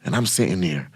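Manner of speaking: ordinary talk, nothing marked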